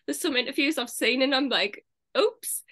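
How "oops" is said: high, sudden little oops; mock oops. Reacting to an actress's accent slip, a high mock oops. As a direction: let it pop up high, as if catching a slip.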